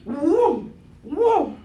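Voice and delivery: loud, high "whoom" for each leap; imitated whoosh. Storyteller imitating two leaps, loud swooping whooms.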